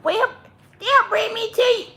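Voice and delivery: high cutesy pet voice; playful pet talk; cat's voice. Pretend. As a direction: speak for the cat, sweet and high. Voicing the cat.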